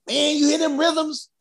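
high, eager retold question; excited. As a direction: marvel at the rhythms. Troy flips out at rhythms.

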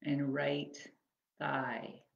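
measured, spacious phrases that leave room to breathe; guided body scan meditation. Acted. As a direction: guide them gently through the body, unhurried.

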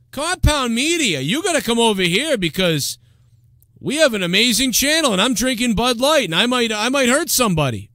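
high-pitched, boastful radio host caricature; Anthony. Acted. Anthony plugging Compound Media, high hammed-up bravado. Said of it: "Now Anthony would be like".

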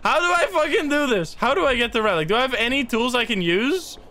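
high, frustrated complaint; exasperated panic. Real, not acted. Gamer stuck without tools, exasperated panic.

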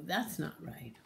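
ordinary delivery, nothing marked

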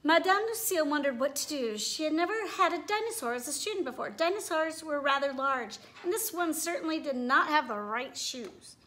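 lively storybook read-aloud with character voices; reading to children. A narrator's reading. Teacher reading a picture book to her class, animated. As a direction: read it like story time for little kids.

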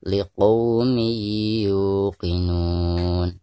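LIQAUMI YUQINUN drawn out, humming into the y; slow recitation. Shown as a demonstration. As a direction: recite "liqaumi yuqinun" very slowly with long held sounds. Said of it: "Kita bacakan dulu".

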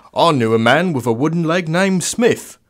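hammed-up Cockney joke teller; Cockney accent. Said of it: "Sorry for the terrible Cockney accent".

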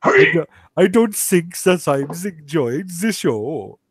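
slow mock-German English; German accent sliding towards Swedish; bad German accent. Shown as a demonstration. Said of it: "with a German accent".

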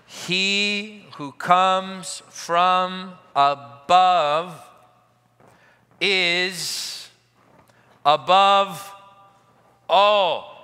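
drawn out, each phrase weighted, long pauses; slow emphatic reading. Shown as a demonstration. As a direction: lean on above and all. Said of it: "Let me say that again".

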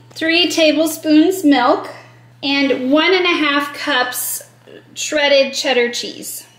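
usual speaking voice

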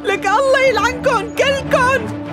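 sobbing scolding, rising to a high curse; arabic mother scolding. Acted. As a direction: cry through the words, then curse them slowly. Wife sobbing over paralysed husband.

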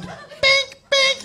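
tiny high-pitched "bink"; mimicked impact noise. Comic shows audience a small woman's hit, high squeak. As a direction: squeak out a little cartoon bink. Imitates hits from small breasts.

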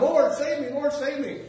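high, loud drowning man's plea; imitated desperate cry to the Lord. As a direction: cry out to be saved. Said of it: "this guy's still crying out".

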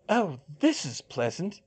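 high, sardonic aside; muttered. A narrator's reading. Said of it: "Hermione muttered".

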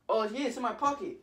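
lightly acted confidence; casual assurance. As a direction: reassure mom as if nothing is wrong. First answer about mom's card.

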